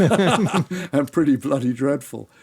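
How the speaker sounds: laughing through a self-mocking joke. Real, not acted. Joking about his own novels.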